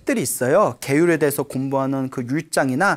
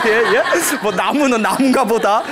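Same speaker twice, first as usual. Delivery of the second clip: laughing mock; 6 semitones higher, 4 dB louder than usual. Mocking careless listeners, laughing.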